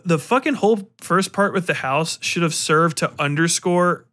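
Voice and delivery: ordinary talk, nothing marked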